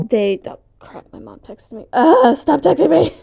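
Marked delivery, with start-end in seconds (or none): 1.9-3.2 loud, high-pitched complaint; exasperated outburst. Real, not acted. Vlogger to mom texting mid-recording, exasperated outburst. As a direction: raise your voice at the interruption. Mom's text interrupts the recording.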